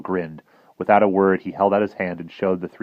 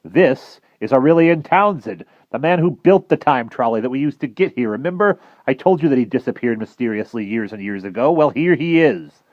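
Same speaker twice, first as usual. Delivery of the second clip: higher, louder emphatic explaining; exasperated professor; 7 semitones higher, 7 dB louder than usual. Acted. Professor explaining Townsend to the boys, exasperated.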